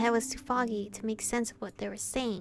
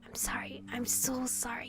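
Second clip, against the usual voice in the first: hushed, breathy "I'm sorry" with no voice; whispered apology; 7 dB quieter than usual. Acted. Brother whispers apologies so guards won't hear, hushed. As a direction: whisper it close to her ear.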